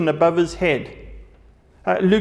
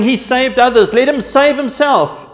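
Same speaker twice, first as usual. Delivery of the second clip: raised, louder mocking taunt; scoffing rulers; 6 semitones higher, 7 dB louder than usual. A narrator's reading. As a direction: sneer it up at him from the crowd. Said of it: "the rulers scoffed at him".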